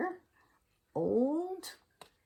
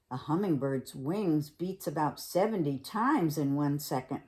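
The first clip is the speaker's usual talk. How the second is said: plain reading voice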